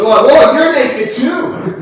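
slow, high and loud mock gasp of shock; drawn-out comic whoa; shocked discovery. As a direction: play Adam and Eve suddenly seeing they're naked, hammed up. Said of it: "And he goes".

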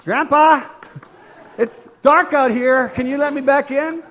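put-on a scared toddler calling grandpa; frightened little boy. Scared toddler begging back in.

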